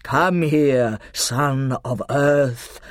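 loud, commanding lion summons; hint of roar. A narrator's reading. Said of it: "with just a hint of roar in it".

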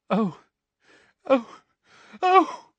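high gulping cries, rising with each one; Molly gulping as she breaks into tears; gulping sobs. A narrator's reading. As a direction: choke out each oh between sobs. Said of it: "gulped Missus Weasley".

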